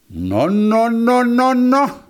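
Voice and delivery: rising, high string of objections; protesting hedgehog. A narrator's reading. Hedgehog objects to the hare's taunt, protesting.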